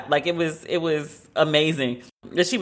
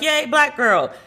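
cheering; 10 semitones higher, slower than usual. Retold or imitated. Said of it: "I was like".

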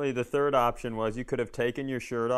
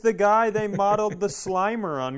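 high, louder starstruck squeal; gushing waitress; 8 semitones higher, 4 dB louder than usual. Retold or imitated. Waitress recognizing him shirtless, high mock excitement. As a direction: gasp like a waitress spotting a celebrity. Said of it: "the waitress goes".

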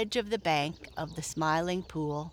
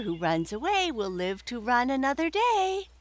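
Peter Rabbit voice; 7 semitones higher, 3 dB louder than usual. Acted. Peter's rhyme, high voice.

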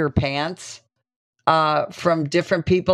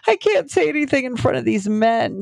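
pitched-up, faked upset plea; a retold crying act, voice raised in pitch; mock tearful plea; 8 semitones higher than usual. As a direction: put on distress as if about to cry. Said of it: "the harder I cry".

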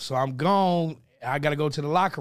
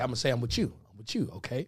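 low, much softer secret plan; conspiratorial aside; 6 semitones lower, 8 dB quieter, much faster than usual. Retold or imitated. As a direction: murmur the scheme out of the side of your mouth. Todd's sneaky plan retold.

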